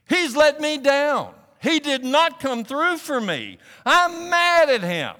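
loud, high, drawn-out angry venting; furious newcomer. Retold or imitated. Newcomer venting at God in a recovery meeting, furious. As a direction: act out a man fuming at God, hitting each grievance hard. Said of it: "furious he was with God".

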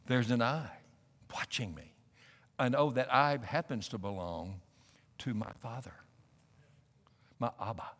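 low, soft sermon voice with long pauses; hushed, reverent preaching. Real, not acted. Preacher telling the congregation the watching eye is his Father's, hushed and reverent. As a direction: speak softly and intimately of a loving Father.

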